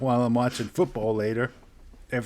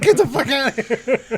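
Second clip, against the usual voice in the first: much higher put-on cat voice, a bit louder; grumpy cat telling you to get lost; annoyed cat voice; 13 semitones higher, 4 dB louder than usual. Voicing his cat for a friend, high cartoon cat voice.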